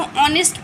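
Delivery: ऑनेस्ट said as "ऑनेस्ट", not हॉनेस्ट; silent h. Shown as a demonstration. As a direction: start ऑनेस्ट on the vowel. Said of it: "हॉनेस्ट नहीं". (ऑनेस्ट बिना ह के)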